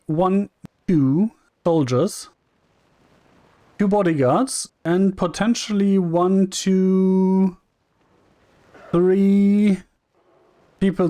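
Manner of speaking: tally each one out loud, slowly; slow counting. Real, not acted. Counting enemies before fight.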